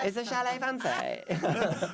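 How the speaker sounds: slow laughing question; giggling, slowed-down repeat. Real, not acted. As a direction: drag it out through laughter.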